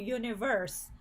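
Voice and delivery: ordinary talk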